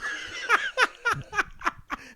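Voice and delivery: high-pitched burst of laughter. Real, not acted. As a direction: crack up high. Laughing after the retold yell.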